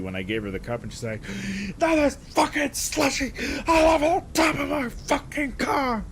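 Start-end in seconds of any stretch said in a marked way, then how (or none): 1.8-6.0 high, loud yell with the voice cracking. Retold or imitated. Driver yelling at speakerphone, cracking high yell. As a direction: yell till the voice breaks. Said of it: "her voice was cracking and shit as she was yelling".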